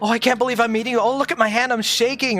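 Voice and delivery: high, breathless starstruck fan begging; gushing fanboy. Retold or imitated. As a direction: squeal like a starstruck fan meeting his idol. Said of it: "And I'm like".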